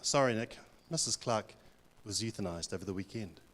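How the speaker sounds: a hint of an awkward nurse breaking news; hospital staff. Hospital staff telling Nick his mother-in-law died, softly. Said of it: "they say to me".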